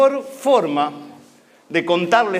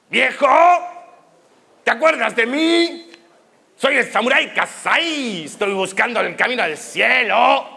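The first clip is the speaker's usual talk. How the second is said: calling out; 4 semitones higher than usual. Acted. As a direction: call for an old man who may not hear you. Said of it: "de gritar un rato largo". (llamando a gritos al viejo)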